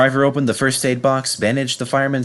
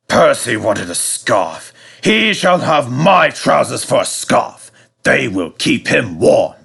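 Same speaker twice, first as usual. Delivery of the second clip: slow, louder verdict voice; stern controller; 2 semitones higher, 4 dB louder than usual. Acted. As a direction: pronounce the punishment with relish. Controller delivering punishment.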